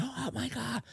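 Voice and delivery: high, hushed mock scream; stifled falsetto shriek, acted out; retold scream. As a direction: imitate her scream in a small high voice. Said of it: "until she starts screaming".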